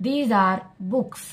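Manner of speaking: very slow, word by word; slow model sentence. Shown as a demonstration. Teacher reading an example slowly to students. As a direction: lay each word down for the class.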